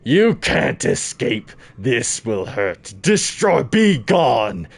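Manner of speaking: lightly voiced menacing boss; slow, loud villain lines. Quoting boss lines dramatically.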